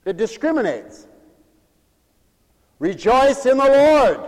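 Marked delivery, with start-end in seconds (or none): 2.8-4.2 high, loud, slow command; preached shout. Real, not acted. Preacher driving the command home, preached shout. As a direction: shout it out, stretching the last word. Repeats the command at full force.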